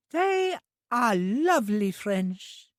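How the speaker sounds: put-on high, slow simple girl's voice; an eager, innocent young woman; high, drawn-out childlike voice; childlike Trudy. A narrator's reading.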